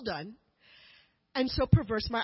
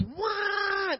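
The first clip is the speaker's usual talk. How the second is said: siren imitation; 9 semitones higher than usual. Speaker imitates the police speedboat siren for the audience, wailing high. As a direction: mimic the police boat siren. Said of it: "It goes".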